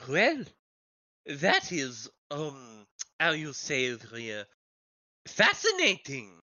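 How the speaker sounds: affected, halting foreign character voice; visiting foreign journalist. Acted. Foreign journalist answering the local gossip, halting and affected. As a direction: search for the word with foreign politeness.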